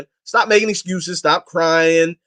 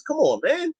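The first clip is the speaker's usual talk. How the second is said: exasperated; 10 semitones higher, 5 dB quieter, slower than usual. Real, not acted. Exasperated plea about imam.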